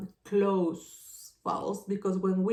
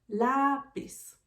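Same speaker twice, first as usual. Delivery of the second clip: "Lápiz" with a drawn-out, higher "Lá"; stress on first syllable; 5 semitones higher than usual. Shown as a demonstration. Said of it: "In the strong syllable".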